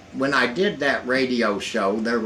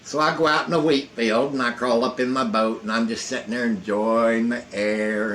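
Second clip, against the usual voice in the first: normal talking voice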